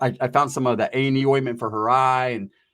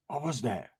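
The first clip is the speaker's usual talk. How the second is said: very soft, low wary question; hushed alarm; 4 semitones lower, 12 dB quieter than usual. Retold or imitated. Man fearing cops at the door, hushed question. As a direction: drop right down, as if not to be heard.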